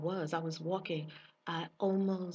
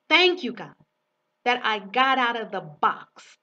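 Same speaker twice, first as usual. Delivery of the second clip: excited thanks; 3 semitones higher, 8 dB louder than usual. Retold or imitated. Retelling the prayer again.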